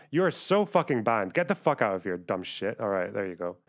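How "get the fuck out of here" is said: usual speaking voice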